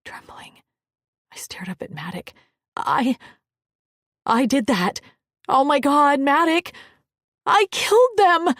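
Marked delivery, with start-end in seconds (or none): 2.8-8.5 high panicked cry; horrified shout at her own hands. Acted. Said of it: "I stared at my hands".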